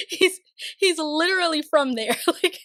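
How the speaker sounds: high, laughing through the words; amused laughing. Real, not acted. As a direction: crack up as you explain. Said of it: "That was just really funny to me".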